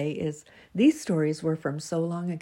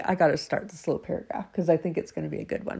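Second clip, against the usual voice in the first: normal talking voice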